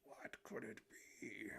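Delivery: slow, soft mysterious whisper; hushed whisper. Acted. Streamer voicing the bystander, slow mysterious whisper. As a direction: whisper it slowly like a secret. Same line repeated, hushed.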